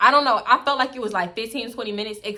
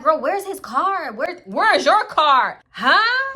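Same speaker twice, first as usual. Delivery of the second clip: high, drawn-out mock challenge; confrontational retort; 7 semitones higher, 5 dB louder, much slower than usual. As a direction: stretch out each question, pitched up. Friend snaps back in quarrel.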